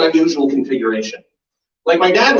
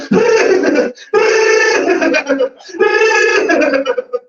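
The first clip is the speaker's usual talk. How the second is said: high rolling mock laugh with trilled sounds; a hammed-up Wookiee-like howl of laughter; imitated wild laugh; 3 dB louder than usual. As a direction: imitate a cackle rolling its R's. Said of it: "an audience member just losing their mind. Just going".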